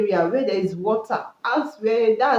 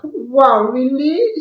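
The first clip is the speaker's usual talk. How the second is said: mock amazed wife; 5 semitones higher, 7 dB louder, much slower than usual. Wife reacts to husband's confession.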